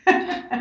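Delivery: breathy little laugh; a quick amused laugh; laughing at the tea remark; short laugh. Real, not acted. Host laughs at tea joke.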